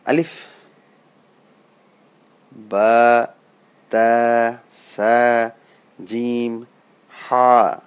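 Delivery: loud, held "বা, তা, সা"; Arabic letter pronunciation. Shown as a demonstration. Teacher repeating letters so learners notice, loud deliberate recitation of "বা, তা, সা". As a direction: project each letter from "আলিফ" to "হা", long vowels. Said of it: "এটি খেয়াল করার চেষ্টা করুন".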